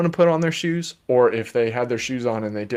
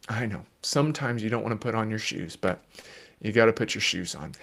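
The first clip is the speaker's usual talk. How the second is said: softer, quick and even reassurance; steady the child without rising; calm parent; 3 semitones lower, 7 dB quieter, faster than usual. Shown as a demonstration. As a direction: parent calmly answering a screaming child. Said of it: "stay calm".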